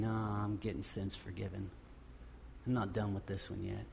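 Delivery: gentle God voice. Acted.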